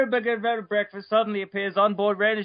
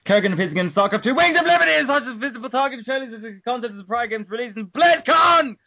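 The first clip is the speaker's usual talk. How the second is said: hyped announcer; 3 semitones higher, 5 dB louder than usual. Acted. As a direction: sell it like a big game reveal.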